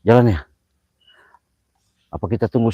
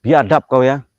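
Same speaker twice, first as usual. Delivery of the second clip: angry call; 6 semitones higher, 5 dB louder than usual. Real, not acted. Shouting at spirit.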